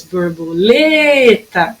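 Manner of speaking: "Borboleta" slowly, LE held long; calling the word. Shown as a demonstration. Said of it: "o lê foi o que durou mais".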